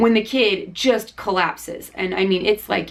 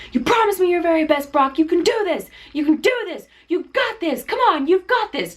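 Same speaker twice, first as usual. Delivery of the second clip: high-pitched, hardcore motivating coach; imitated screaming coach; 9 semitones higher than usual. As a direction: urge him on like a yelling coach. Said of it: "this coach just keeps screaming".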